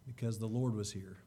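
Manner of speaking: ordinary talk, nothing marked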